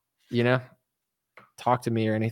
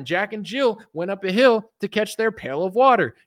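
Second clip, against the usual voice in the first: high excited mock believer; hammed-up QAnon fan; mock conspiracy fan; 8 semitones higher than usual. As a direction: gush like a conspiracy nut. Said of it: "QAnon people are like".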